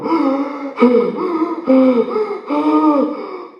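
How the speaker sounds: a big, high, loud gasp for air; sharp gasp. Retold or imitated. Waking from choking, he gasps loudly for air. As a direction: gasp like waking up unable to breathe. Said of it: "I shoot up going".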